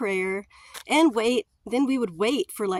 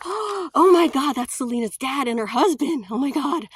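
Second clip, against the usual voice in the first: starstruck gasp; 4 semitones higher, 5 dB louder than usual. Retold or imitated. Spotting [name]'s dad at convention.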